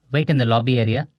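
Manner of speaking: brisk, fast company representative; quick, businesslike interviewer voice; lightly voiced HR interviewer. A narrator's reading. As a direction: rattle it off like a recruiter wrapping up an interview.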